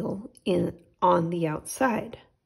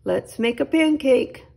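put-on eager boy; 5 semitones higher, 6 dB louder than usual. Jack eager for pancake.

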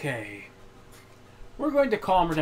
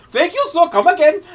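higher, louder mock Indian shopkeeper accent with Apu's lilt; Apu impression; 6 semitones higher, 5 dB louder than usual. Mocking his friend's voice, a higher, louder mock Indian accent. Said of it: "You was pulling Apu out your".

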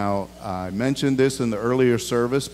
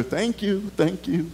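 distracted flight attendant; 6 semitones higher, 3 dB quieter than usual. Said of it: "so they start doing other".

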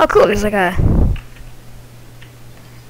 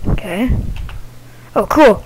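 ordinary delivery

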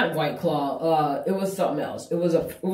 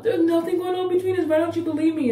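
much higher mocking imitation of her pleading; mocking fiance; 13 semitones higher than usual. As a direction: mimic her whiny denial, pitched way up. Mocking his fiance's denial.

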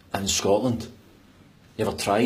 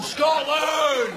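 very high, long yell; defiant battle cry. Acted. Trapped Scot joining his mate, battle cry. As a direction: scream it like a war chant.

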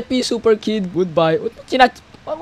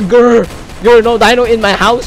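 growling dino; 4 dB louder than usual. Retold or imitated. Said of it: "Sabi niya".

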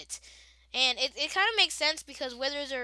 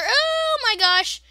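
slow, high, louder oh my gosh; alarmed; 11 semitones higher, 8 dB louder, much slower than usual. Real, not acted. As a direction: stretch it in panic. About to die in game.

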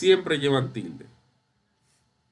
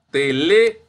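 te-LÉ, second syllable punched louder; stress on second syllable; 2 semitones higher, 7 dB louder than usual. Shown as a demonstration. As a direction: break off "Tele" on a loud LÉ. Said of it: "no telefonó".

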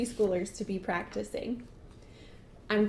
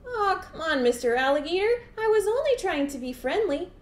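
high, wheedling dog whine; pleading storybook whine; whining; 9 semitones higher, 4 dB louder than usual. Acted. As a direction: whine your innocence to talk your way out. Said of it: "Dog whined".

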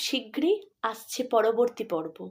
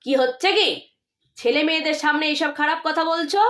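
louder stern rebuke, quick at the end; scolding; 5 semitones higher, 4 dB louder, faster than usual. Acted. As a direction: snap at her in front of the children. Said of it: "ধমকের সুরে বলেন".

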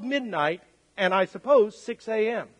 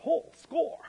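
higher, softer gleeful aside; quietly delighted at a lucky windfall; sly glee; 6 semitones higher, 6 dB quieter than usual. Acted. Imagined customer pocketing extra change.

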